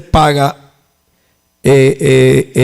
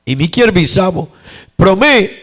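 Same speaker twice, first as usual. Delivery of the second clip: ordinary talk, nothing marked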